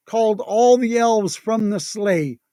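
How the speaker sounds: plain reading voice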